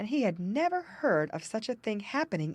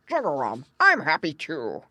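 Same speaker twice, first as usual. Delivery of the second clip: louder, a hint of croaking frog; old frog croak; 2 semitones lower, 7 dB louder than usual. A narrator's reading. Frog voiced.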